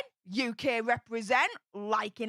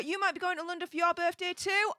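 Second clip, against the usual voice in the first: ordinary talk, nothing marked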